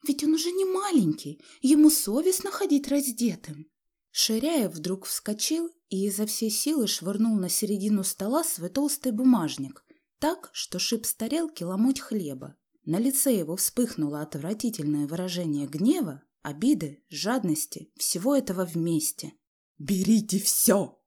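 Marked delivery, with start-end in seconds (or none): 0.1-3.7 high-pitched fretful wife's voice; oblivious nagging wife. A narrator's reading. Wife going on about her son to her fuming husband, high nagging. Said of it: "словно не понимая, что делается с её мужем, продолжала". 19.8-21.0 loud, slow, drawn-out yell; frantic shout. A narrator's reading. Enraged father flinging his wallet on the table, frantic shout. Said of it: "крикнул он не своим голосом".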